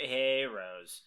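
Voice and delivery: usual speaking voice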